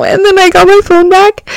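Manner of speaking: excited high burst; excited loud. Real, not acted. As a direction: blurt it out, excited. Excited retelling.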